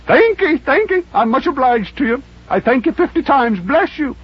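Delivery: put-on high, thin old man voice; gushing thanks from a high old voice; grateful old Scrooge. Scrooge thanking the gentleman, high and overjoyed.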